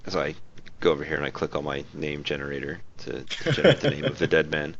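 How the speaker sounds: usual speaking voice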